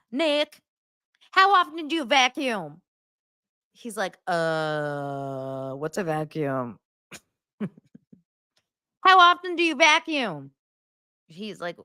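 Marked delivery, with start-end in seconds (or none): 0.1-2.8 high-pitched condescending grilling; hammed-up fussy demand; mock interrogation. Mocks a condescending woman's interrogation. 4.3-6.8 low, slow, dopey mock voice; imitation of a dim guy; clueless boyfriend. Plays the clueless boyfriend answering. 9.0-10.5 much higher, insistent nagging question; hammed-up condescending demand; mock interrogation. Repeats the woman's interrogation.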